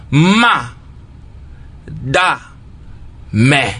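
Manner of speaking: "Ma, da, mais." said as three separate beats with pauses; split into syllables. Shown as a demonstration. Teacher on radio showing pupils the syllables of madame, syllable by syllable. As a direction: tap out Ma, da, mais one syllable at a time. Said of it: "trois émissions de sons".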